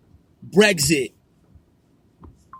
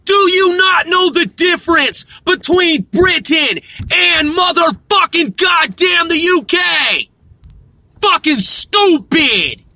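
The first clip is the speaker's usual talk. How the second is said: yelling at a dumb search engine, raised pitch and loud; furious yelling; 6 semitones higher, 3 dB louder than usual. Real, not acted. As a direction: blow up at the computer.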